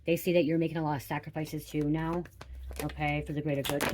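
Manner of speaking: normal talking voice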